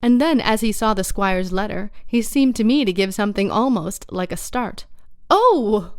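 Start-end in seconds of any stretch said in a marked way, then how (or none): 5.3-5.9 sudden loud Oh of surprise; loud exclamation. A narrator's reading. Silver startled by the squire's letter, loud Oh. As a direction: blurt it out loud. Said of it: "quite loud".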